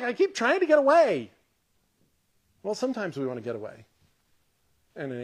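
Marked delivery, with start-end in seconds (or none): 0.0-1.3 mock-irritated, higher and louder; irritated David. Preacher voicing David's psalm as an irritated complaint. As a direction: play David fed up at being found. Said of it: "sounds like David's a little bit irritated".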